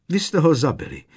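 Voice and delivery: high, loud accusing cry; distraught accusation. Acted. House-elf accusing the professors, high anguished cry. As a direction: fling it at them in grief.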